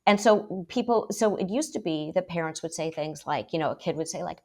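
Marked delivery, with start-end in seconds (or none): none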